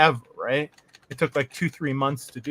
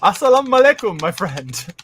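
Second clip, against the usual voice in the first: loud, higher hammed-up Arabic greeting; mock Arabic accent; 4 semitones higher, 7 dB louder than usual. Trolling the FBI caller with a mock Arabic accent. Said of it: "And I just go".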